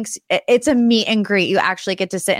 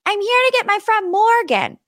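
high, bright-voiced announcement; bubbly announcement; 12 semitones higher than usual. [name] collecting her friend, high bubbly announcement. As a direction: sweep in and announce it.

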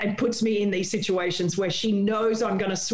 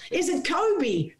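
slow, higher mock yell of the name; mock producer yell; 8 semitones higher, slower than usual. Producer yelling the question through the earpiece, slow higher call. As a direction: call it into her ear. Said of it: "my producer yells in my ear".

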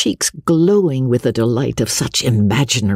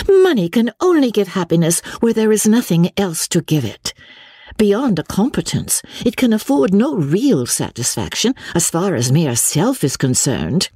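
put-on higher, emphatic young woman; earnest young Marianne; 8 semitones higher than usual. A narrator's reading. Marianne arguing with her sister about money, earnest. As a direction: argue it earnestly in a raised pitch.

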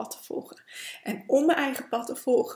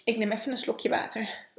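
ordinary delivery

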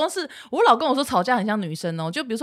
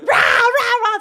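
higher, louder, laughing yell; mock roaring; 8 dB louder than usual. Wife imitating husband roaring in a fight, mock yelling with laughter. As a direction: ham up his roaring. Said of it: "在开始狂吼欸".

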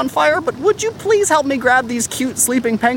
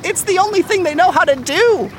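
normal talking voice

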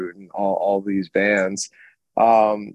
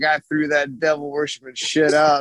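higher-pitched mock mom voice; imitated mom; 4 semitones higher than usual. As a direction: voice a disapproving mom. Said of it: "Mom was like".

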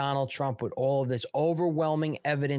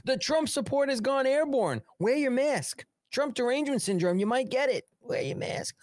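mock health official; 11 semitones higher than usual. Mock health warning, high voice.

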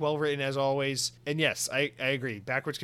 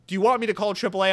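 higher, louder worried questions from the next car; concerned stranger; 6 semitones higher, 4 dB louder than usual. Retold or imitated. Driver in the next lane checking on him, alarmed and louder. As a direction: sound alarmed for a stranger in distress. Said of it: "the guy next to me will be like".

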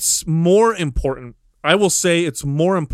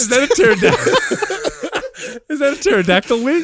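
laughing; 9 semitones higher than usual. Real, not acted. Laughing mock discovery.

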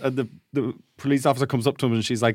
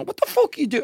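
much higher indignant cry; mock outraged friend; 11 semitones higher than usual. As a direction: react like the couch is ruined. Friends about to accuse.

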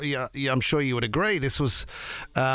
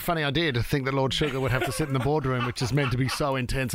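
normal talking voice

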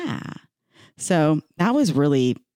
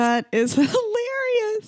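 high slow laughing words; slower than usual. Real, not acted. Laughing at the story.

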